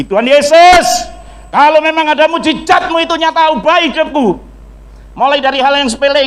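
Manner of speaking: fervent shouted plea for a changed life; crying out to God. Retold or imitated. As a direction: cry out to Jesus at the top of your voice. Desperate prayer retold in church.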